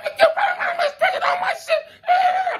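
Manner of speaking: very high, slow laughing shout; laughing mock threat. Laughing high mock of Omar's threat with the gun. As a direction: squeal the threat, cracking up.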